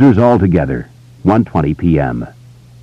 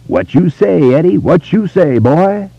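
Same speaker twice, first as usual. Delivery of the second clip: grandly rolling Southern gentleman voice, higher; Foghorn Leghorn Southern drawl; 12 semitones higher, slower than usual. Retold or imitated. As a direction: ham up a cartoon Southern rooster gentleman. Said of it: "his grandly rolling Southern gentleman voice".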